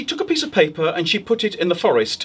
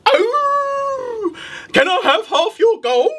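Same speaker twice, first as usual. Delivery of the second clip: wolf asking; slower than usual. Acted. Wolf to the witch after cleaning, squeaky plea. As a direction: beg for the gold in a squeaky wolf voice. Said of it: "the wolf said".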